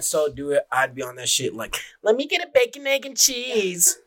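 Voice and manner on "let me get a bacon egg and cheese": put-on drive-thru voice. Prankster ordering at the drive-thru, in a high put-on voice. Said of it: "They put on a voice".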